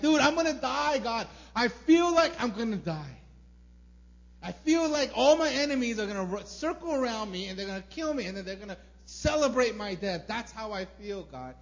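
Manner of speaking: loud, high, pleading outburst; desperate cry to God. Retold or imitated. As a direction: shout it out like you think you'll die. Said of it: "not trying to sound all righteous and holy".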